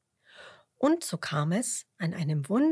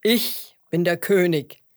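loud, self-assured lion; proud declaration; 7 dB louder than usual. A narrator's reading. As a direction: declare your rank proudly and loudly. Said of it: "erklärte der Löwe stolz". (stolz verkündender Löwe)

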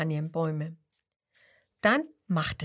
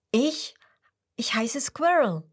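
higher, hesitant "Ich, ich" squirrel; 4 semitones higher, 3 dB louder than usual. Acted. Squirrel introducing itself, higher and hesitant. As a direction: stammer a little before the name.

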